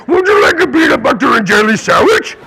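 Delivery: goofy put-on cartoonish voice; silly made-up accent. Shown as a demonstration. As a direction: demonstrate a nonsense accent, hammed up. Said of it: "doesn't have to be a real accent".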